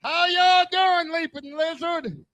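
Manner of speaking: big stretched-out hello to the room. Acted. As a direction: call it out high and slow to the crowd. Comic greets crowd, high call.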